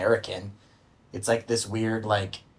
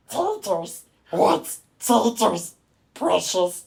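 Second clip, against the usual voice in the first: high, creaky little creature voice; Smeagol; 9 semitones higher, 4 dB louder, much slower than usual. Acted. Host shows off to podcast listeners, Smeagol impression. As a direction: drag the words out in a high, rasping creature voice. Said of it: "I could do a really good Smeagol impression".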